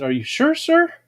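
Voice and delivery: rising, doubtful nurse voice; lightly voiced unsure nurse. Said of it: "And she said".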